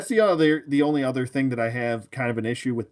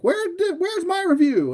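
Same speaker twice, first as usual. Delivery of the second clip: very high, louder mock complaint; mock whining viewer; 5 dB louder than usual. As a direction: imitate a demanding fan in a squeaky-high voice. Mimicking impatient viewers' complaint.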